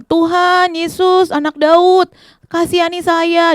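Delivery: loud, high, drawn-out cry; calling out pleading. Acted. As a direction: shout a plea for mercy. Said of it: "Dia panggil namanya". (seruan panjang memohon belas kasihan)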